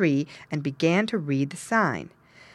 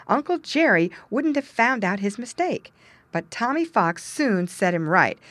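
ordinary delivery

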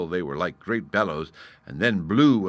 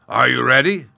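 loud, raised call; roaring challenge; 5 semitones higher, 11 dB louder than usual. Acted. Lion about to fight the gnat, loud challenge. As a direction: call out the start of a duel.

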